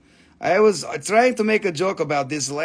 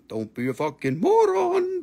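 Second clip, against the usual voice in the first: "Don't be a fucking moron." repeated much higher, mock scolding; 10 semitones higher than usual. Shown as a demonstration. Seller teasing buyers, repeating "Don't be a fucking moron." in a high mock voice. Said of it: "It's a, don't be a fucking moron".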